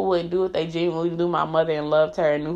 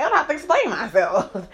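retold defiance; 5 semitones higher than usual. Retold walkout line.

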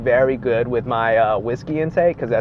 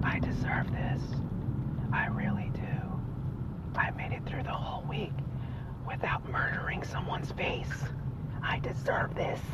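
soft whisper to himself; whispered self-talk; 5 semitones higher, 16 dB quieter than usual. Retold or imitated. Telling himself he earned the drink, whispered. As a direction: whisper it like a private reward. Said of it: "I tell myself in the back of my mind".

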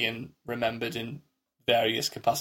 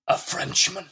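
a touch higher, sneering at the word Frenchman; mock disdain; 4 semitones higher, slower than usual. Friend retelling the housekeeper's line, mild mock disdain. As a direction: scoff lightly at "Frenchman". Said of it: "and saying it with venom".

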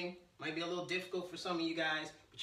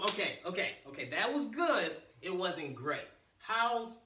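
ordinary delivery

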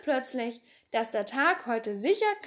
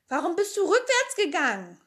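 Tommy's question; 8 semitones higher, 5 dB louder than usual. Acted. Tommy asks Pippi.

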